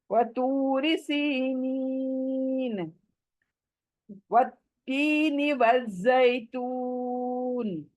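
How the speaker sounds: slow, beautified Quranic recitation; melodic recitation. Shown as a demonstration.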